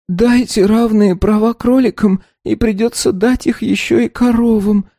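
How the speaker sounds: muffled, face in the tablecloth; head on desk. A narrator's reading. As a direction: mumble with face pressed to table. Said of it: "уткнувшись лицом в скатерть".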